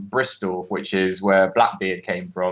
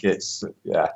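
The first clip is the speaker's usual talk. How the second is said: normal talking voice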